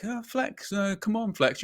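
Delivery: high, soft teasing mimic of a mate; mock KG needling. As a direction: needle him in a high, sing-song tease. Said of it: "He'll be like".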